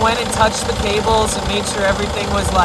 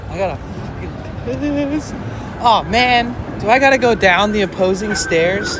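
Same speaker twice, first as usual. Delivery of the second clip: urgent need to pee; 5 semitones higher, 4 dB louder than usual. Real, not acted. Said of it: "I have to pee so bad".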